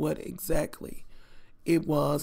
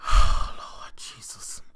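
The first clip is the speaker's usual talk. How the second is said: sighing whispered lament; 8 dB louder, slower than usual. Real, not acted. Overwhelmed sigh recalling the itching.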